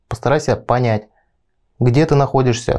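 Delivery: usual speaking voice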